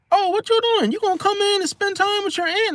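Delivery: very high-pitched mock auntie voice; pushy auntie. Aunt pressing nephew to come in, high-pitched coaxing. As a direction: coax him inside in a piping high voice. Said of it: "They're like".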